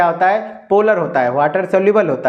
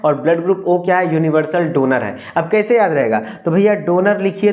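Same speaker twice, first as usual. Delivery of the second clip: usual speaking voice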